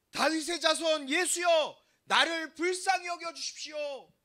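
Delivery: slow, high, full-voiced shout. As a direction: cry out with all your might, stretching each phrase. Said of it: "더 큰 소리로".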